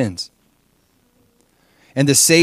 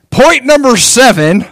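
mock preacher; 12 semitones higher, 10 dB louder, slower than usual. Mocking Paul preaching on.

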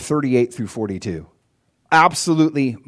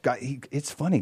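ordinary talk, nothing marked

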